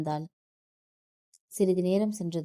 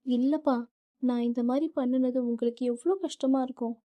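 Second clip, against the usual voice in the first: higher tearful plea; crying; 5 semitones higher than usual. A narrator's reading. Daughter to father, still crying in guilt. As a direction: cry it out, full of guilt. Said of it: "மீண்டும் மீண்டும் அழுது கொண்டே இருக்க".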